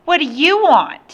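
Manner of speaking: put-on high, loud bratty child; rude boy's voice. A narrator's reading. Boy opening the door to the man, rude and loud. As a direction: demand it rudely as a child.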